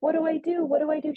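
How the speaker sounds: high-pitched imitation of panic; freaking out. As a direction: mimic a friend in a panic, pitched right up. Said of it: "She's freaking out".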